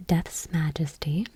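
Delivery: usual speaking voice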